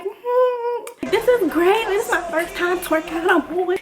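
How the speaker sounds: high-pitched, thrilled self-narration; high mock excitement; delighted reenactment. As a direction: gush with giddy, put-on delight. Acts out delight at first twerk.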